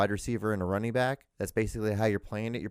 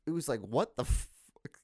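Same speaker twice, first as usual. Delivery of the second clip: normal talking voice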